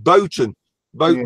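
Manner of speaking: 'Boughton' with the L dropped; local pronunciation. Shown as a demonstration. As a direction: say 'Boughton' like 'boat-n'. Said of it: "we call it".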